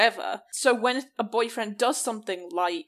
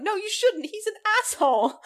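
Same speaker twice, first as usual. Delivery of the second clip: much higher, shrill mock cry of protest; mock outraged shout; 11 semitones higher than usual. Friend retells yelling at the film's heroine in mock outrage. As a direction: shriek back at the screen in mock outrage. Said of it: "It's like".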